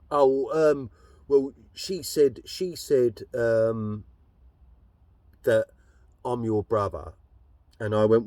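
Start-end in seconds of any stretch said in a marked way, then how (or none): 0.1-7.1 hunt for the words awkwardly; lightly voiced awkward stepbrother; hesitant stepbrother.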